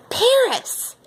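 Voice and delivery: high thrilled cry of Paris!; excited squeal. Acted. Girl to new classmate, squealing with excitement. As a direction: squeal the city name with delight.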